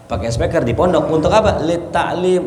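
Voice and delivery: ordinary delivery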